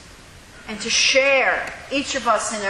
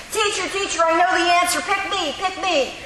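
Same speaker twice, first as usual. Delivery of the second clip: high, eager teacher's-pet voice; eager smarty-pants kid; 9 semitones higher than usual. Retold or imitated. Preacher voices the front-row know-it-all begging the teacher, high and eager. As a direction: squeal to be picked like a hand-waving schoolkid. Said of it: "waving his hand saying".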